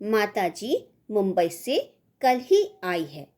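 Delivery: normal talking voice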